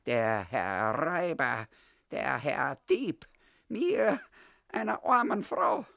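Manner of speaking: slow, sneering "Herr Räuber", then a high wail; landlady sneering at the boy as a thief, then wailing; sarcastic lament. Acted. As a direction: mock-courtly sneer turning to self-pity. Frau Steindl mocks the thief.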